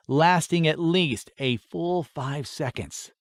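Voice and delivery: plain reading voice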